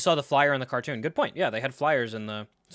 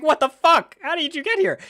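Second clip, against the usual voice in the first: high, very loud shocked yell; acted freak-out; 9 dB louder than usual. Acting how the Avatar should react to Iroh, freaking out. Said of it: "everyone's freaking the fuck out".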